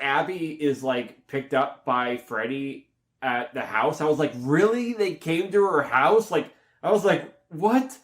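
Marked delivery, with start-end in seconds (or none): 4.4-6.3 high, louder replayed disbelief; scoffing surprise, stretched out; incredulous. Re-enacts disbelief at plot point. 7.5-8.0 high rising incredulous question; mock-stunned, pitched up; baffled disbelief. Re-enacts disbelief at plot point.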